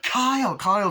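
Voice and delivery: high, loud urgent calls; calling out. Retold or imitated. Andy calls to Kyle at the belt, urgent high calls. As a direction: shout to warn her. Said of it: "and Andy's like".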